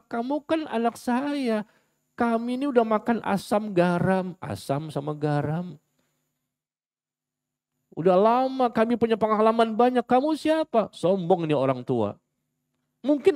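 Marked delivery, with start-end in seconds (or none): 0.1-10.9 put-on higher, haughty elder dismissing the child; mock arrogant old parent; proud parent. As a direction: scoff at the child from above.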